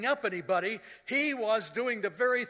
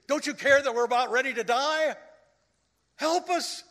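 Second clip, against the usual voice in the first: panicked plea; 8 semitones higher, 8 dB louder than usual. Retold or imitated. Terrified disciples cry to Jesus.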